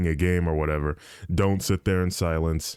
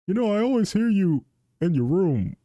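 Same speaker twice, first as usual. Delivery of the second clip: imitating dad in a higher pitch; lightly mocking, pitched-up dad voice; 11 semitones higher than usual. Dad remarking to his streamer son, high imitated voice. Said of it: "he's like".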